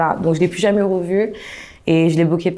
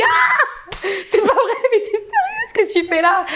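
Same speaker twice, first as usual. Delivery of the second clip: shocked squeal; 14 semitones higher than usual. Retold or imitated. Shocked to meet her ex.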